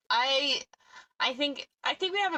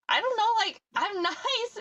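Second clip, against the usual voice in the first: high-pitched, imitated protest of innocence; retold mild protest; 12 semitones higher, slower than usual. Insisting to the maintenance guy she is nice, high protest. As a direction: protest in a squeaky-high voice, half laughing.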